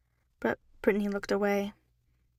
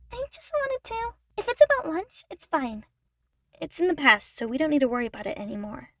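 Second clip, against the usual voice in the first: much higher, sweet little chipmunk sister; Eleanor, eager; 6 semitones higher, 6 dB louder than usual. Acted. Eleanor tells Brittany lunch is forgotten, eager. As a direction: rush it out, trying to make peace.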